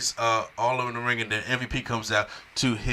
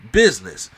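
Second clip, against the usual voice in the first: "business" set apart by a pause and hit louder; emphasis on business; 4 semitones lower, 6 dB louder than usual. Retold or imitated. Quoting Lesnar at the VIP lounge, heavy stress on "business". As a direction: lean hard on the word "business". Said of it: "a lot of emphasis on the word business".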